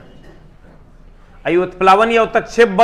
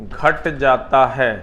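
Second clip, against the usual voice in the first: slow, lower dictation with long pauses; 5 semitones lower, 4 dB quieter, slower than usual. Real, not acted. Teacher to students writing notes, slow dictation. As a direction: spell it out slowly for note-takers.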